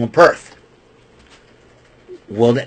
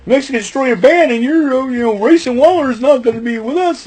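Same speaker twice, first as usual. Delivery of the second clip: mock whining, higher pitched; whiny crybaby; 9 semitones higher than usual. Mocking New Day whining to L A Knight about getting banned. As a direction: whine like a crybaby in a light put-on voice. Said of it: "starts whining and crying".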